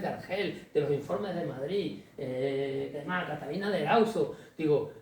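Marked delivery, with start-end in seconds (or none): none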